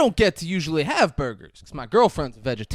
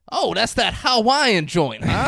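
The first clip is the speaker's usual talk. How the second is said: imitating Samuel L. Jackson; 8 semitones higher, 6 dB louder, slower than usual. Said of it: "He goes".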